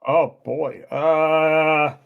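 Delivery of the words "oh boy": ordinary talk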